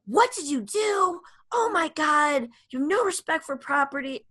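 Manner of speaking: imitating a furious yell. Mom yelling at her son over the car, hammed-up rage. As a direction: replay the outburst like the ex would. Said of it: "which is yell like crazy".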